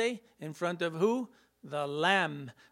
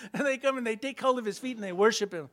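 usual speaking voice

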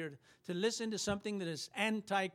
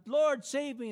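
higher, louder desperate cry for help; 6 semitones higher, 6 dB louder than usual. Acted. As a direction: cry out as if sinking. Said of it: "he cried out".

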